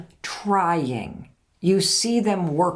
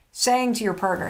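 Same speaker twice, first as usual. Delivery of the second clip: ordinary delivery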